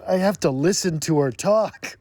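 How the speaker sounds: mock exasperation, rising high; Edward. Vampire forced to listen to her, mock-anguished. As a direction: whine it up into a pained groan. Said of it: "he's like".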